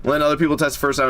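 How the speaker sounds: ordinary delivery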